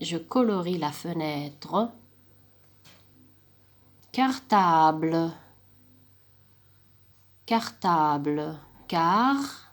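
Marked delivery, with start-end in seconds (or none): 4.1-9.6 "Cartable" said slowly, split into syllables; syllable-split modelling. Shown as a demonstration. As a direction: chop "Cartable" into its syllables for pupils. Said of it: "تقسموا لهم الكلمة en syllabe".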